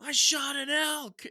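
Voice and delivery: high, drawn-out holler; high-pitched shout with stretched words; retold scream. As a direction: call to a friend far off in the woods. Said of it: "we're just screaming in the woods".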